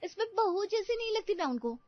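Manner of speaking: high-pitched, exasperated complaint; angry young wife. Acted. Wife complains to husband about the heavy sari, angry. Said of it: "रिया गुस्से में".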